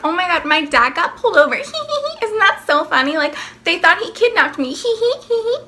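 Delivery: fast, high giggling brag; giddy kid showing off a juicy story; giggly bragging. Retold or imitated. Telling friends about Dad getting pulled over, giggling.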